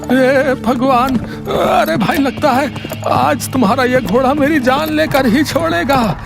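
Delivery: stretched, wailing cries of alarm; panicked merchant. Acted. Merchant fearing for his life behind runaway horse, panicked. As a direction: draw out the opening cries, hammed-up fear.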